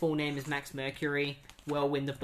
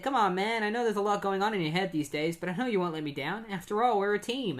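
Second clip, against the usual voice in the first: higher-pitched, hopeful appeal; urgent coaxing; 5 semitones higher, faster than usual. Retold or imitated. Wally trusts Barry to pull him through, coaxing. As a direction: coax a teammate who is wavering.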